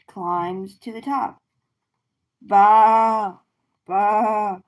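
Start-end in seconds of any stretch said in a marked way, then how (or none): 2.4-4.6 loud bleating baa; goat bleat. Pretend. Reading to kids, a loud goat bleat. Goat sound in animal book.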